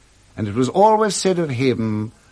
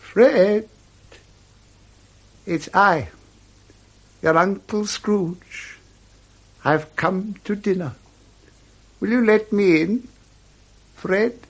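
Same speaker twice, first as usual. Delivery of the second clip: higher, eager plea to be let in; hopeful Scrooge at the door; 5 semitones higher than usual. Acted. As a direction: ask timidly to join dinner. Scrooge asks to come in.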